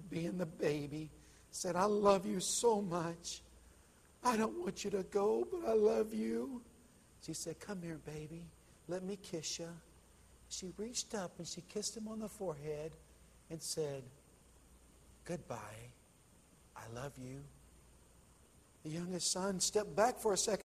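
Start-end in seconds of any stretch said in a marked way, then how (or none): 1.8-6.6 pleading son. A narrator's reading. Youngest son pleads, being the baby. 7.6-17.4 faint farewell. A narrator's reading. Dying mother's last goodbye.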